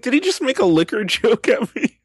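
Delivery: high, incredulous question breaking into laughter; laughing disbelief. Retold or imitated. Man reliving a stranger's liquor joke, laughing in disbelief.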